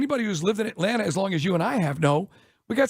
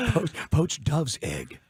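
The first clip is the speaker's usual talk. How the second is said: snooty waiter voice; 3 semitones lower, 3 dB quieter, slower than usual. Acted. Mocking a pretentious server.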